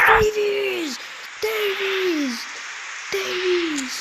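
calls the name out loud, high and excited; repeated excited name calls; excited shouting. Real, not acted. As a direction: hype yell at the screen. Calling out player name excitedly.

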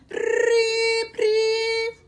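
high rolled-r whirring; blender whir imitation. A narrator's reading. Narrator mimics dragons blending a strawberry shake, high whir.

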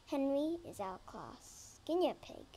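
plain reading voice

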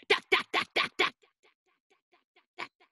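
hard unvoiced "dak" bursts; jackhammer. Shown as a demonstration. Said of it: "It makes a loud banging sound".

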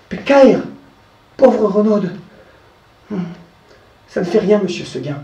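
lightly voiced goat, unconcerned; carefree little goat. The goat dismissing the wolf story, light and carefree. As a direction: shrug it off sweetly.

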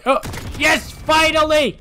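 high, loud relieved cheer; triumphant cheer. Real, not acted. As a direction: celebrate the kill. Finally gets a kill.

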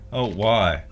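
normal talking voice

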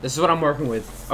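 normal talking voice

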